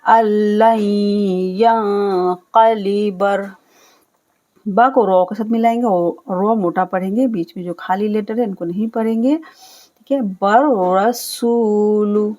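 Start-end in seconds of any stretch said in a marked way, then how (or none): 0.0-3.5 slow, drawn tajweed recitation; measured Quranic recitation. Shown as a demonstration. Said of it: "लन नहीं पढ़ना है, गुन्ना करना है". 10.2-12.3 रसूलु with the सू held long and लु short; slow recitation of रसूलु; drawn-out su. Shown as a demonstration. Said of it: "सीन को खींचना है सू".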